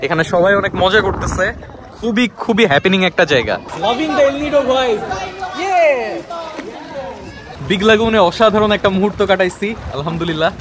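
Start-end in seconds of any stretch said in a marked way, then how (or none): 3.8-6.2 high, slow, delighted exclamation; excited. Real, not acted. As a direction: savour the vibe in a sung-out high voice. (উচ্ছ্বসিত উঁচু গলায় ধীরে)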